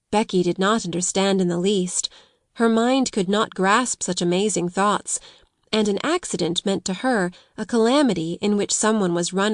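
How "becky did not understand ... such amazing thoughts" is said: usual speaking voice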